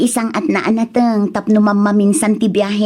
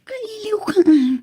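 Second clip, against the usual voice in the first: tender whisper; 10 semitones higher than usual. Acted. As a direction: breathe it close to his ear. (malambing na pabulong)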